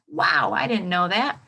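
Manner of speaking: usual speaking voice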